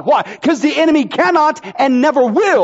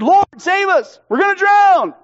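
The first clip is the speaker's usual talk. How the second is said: raised, higher cry for help; panicked disciples; 7 semitones higher than usual. Retold or imitated. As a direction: yell like men about to drown. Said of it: "they woke him up, said".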